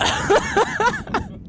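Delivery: big burst of high, loud laughter. Real, not acted. Laughing over the stressful announcement, loud high laugh. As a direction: crack up loudly and high.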